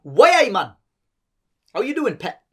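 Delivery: high, swooping Geordie greeting; lilting, loud-starting Geordie; Geordie accent. Shown as a demonstration.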